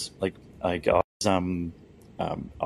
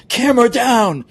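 loud, high-pitched yell from the back; yelled order; 12 dB louder than usual. Retold or imitated. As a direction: imitate a cameraman yelling an order across the room. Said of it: "he yells out".

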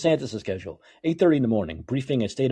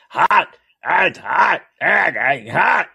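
high, loud Trump-style shouted repeats; mock screaming; 6 semitones higher, 6 dB louder, much slower than usual. Host mocks Trump bragging about his platform, mock screaming. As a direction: bellow it like a ranting politician. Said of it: "When he's screaming".

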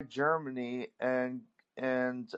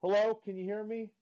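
far from mic; 8 semitones higher, 5 dB louder, faster than usual. Real, not acted. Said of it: "it sounds like you're far away from your microphone".

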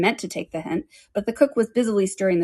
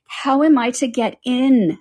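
louder, insistent repeat; 5 semitones higher, 5 dB louder, slower than usual. A narrator's reading. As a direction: ask it again, louder. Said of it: "Alice asked again in a louder tone".

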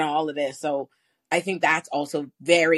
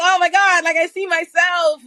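excited; 11 semitones higher, 8 dB louder, slower than usual. Real, not acted. Sees herself in speaker's story.

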